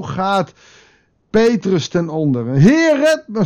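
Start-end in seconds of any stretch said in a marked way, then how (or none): 2.5-3.4 very high, loud panicked plea; sinking Peter crying out to the Lord; desperate cry. Retold or imitated. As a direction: cry out as if drowning. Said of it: "schreeuwt hij het uit".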